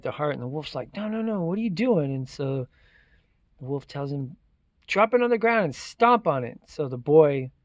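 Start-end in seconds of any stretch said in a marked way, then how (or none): 0.9-2.1 urgent protest. Acted. Wolf stops boy giving heart. 4.9-6.6 urgent command. Acted. Wolf orders boy to destroy heart.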